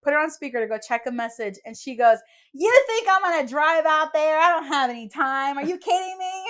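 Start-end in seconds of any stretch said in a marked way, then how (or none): 2.5-6.4 higher, louder retold outburst from mom; imitating a mother snapping loudly, pitched up; stressed, exasperated mom. Bride mimics her stressed mom refusing to drive over, exasperated. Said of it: "my mom's not usually like that".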